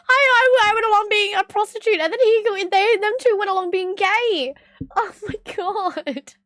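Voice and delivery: high-pitched, breaking into laughter; real laughter lifting the whole voice; cracking up. Real, not acted. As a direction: push the words through helpless laughing. Cracking up at the shared absurdity.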